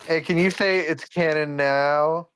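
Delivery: high, slow mock-fan request; pleading fan. Fan at an autograph signing asks, pleading. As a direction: draw it out high like an eager fan.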